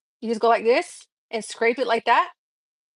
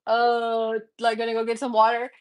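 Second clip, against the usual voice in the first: half asleep. Retold or imitated. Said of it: "you're half asleep".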